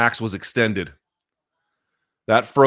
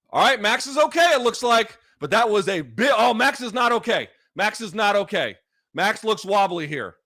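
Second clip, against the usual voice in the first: higher, rapid, keyed-up play-by-play; tense excitement; 9 semitones higher than usual. Real, not acted. Streamer tracking a wobbly fighter, keyed-up and tense. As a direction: caught between worry and hype. Live reaction, fighter hurt.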